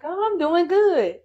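high breezy fake-fine voice; mock cheerful. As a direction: brush it off brightly. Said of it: "you're like".

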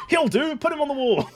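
very high put-on voice; mock customer. Customer picking the man for the wall, silly high voice.

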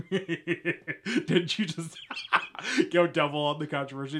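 words broken up by giggles; laughing. Real, not acted. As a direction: crack up while teasing. Teasing Christians, cracking up.

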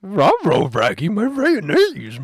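high, slow cartoon dog voice; Scooby-Doo voice.

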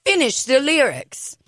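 a hint of a man's deadpan request; imitating the guy. Imitating a stranger at the gym asking her to finish the lyrics, lightly voiced. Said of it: "That's exactly how he says it".

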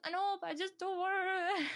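high, hammed-up whine; whiny protest. Retelling own refusal to pushy dad, whiny.

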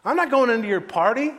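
high, loud angry refusal; acted outraged outburst; sudden anger. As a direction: refuse at full indignant volume. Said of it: "All of a sudden he was angry".

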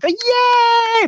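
high, loud cheer; kids cheering. Retold or imitated. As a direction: shriek with delight like third graders. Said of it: "the kid's like".

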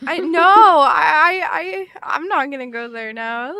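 stammering, whiny mock dread, slowing on the first words; half-joking whimper. Real, not acted. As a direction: recoil from the idea of bugs with a whimper. Horrified by mosquito talk.

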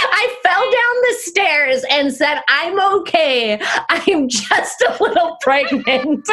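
high, slow, laughing retelling; helpless giggly retelling of own blunder; cracking up. Real, not acted. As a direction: barely get the story out through laughter.

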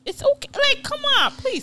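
high-pitched, exasperated protest; exasperated plea. Real, not acted. Defending older mothers against judgment, exasperated and high.

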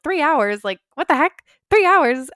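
rising to a loud, incredulous shout; outraged disbelief at a three-hour wait; incredulous outburst. Retold or imitated. Told the ambulance takes three hours.

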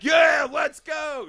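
slow, loud, high cheer; excited cheer. Retold or imitated. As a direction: shout it out, drawn out and high. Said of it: "I was like".

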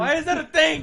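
laughing through the question, high. Real, not acted. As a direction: ask it while cracking up. Laughing at friend's phrase.

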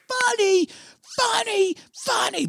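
very high, strained mock cries; imitated enraged yelling. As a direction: punch out each word while firing. Acting out enraged shooting of bartender.

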